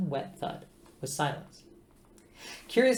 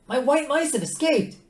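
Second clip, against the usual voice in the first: much higher, louder, fretting about her mice; high anxious female voice; alarmed Trillian; 7 dB louder than usual. A narrator's reading. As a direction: squeal it out in a panic. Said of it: "she said".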